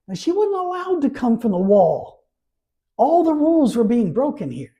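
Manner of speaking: high and loud, building fervour; rising pulpit intensity; fervent emphasis. Real, not acted. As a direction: preach it out with fire. Preacher stressing broken rules.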